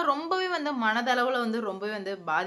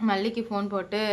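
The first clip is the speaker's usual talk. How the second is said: normal talking voice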